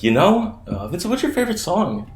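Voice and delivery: lightly voiced buddy asking; casual friend. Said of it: "And he's like".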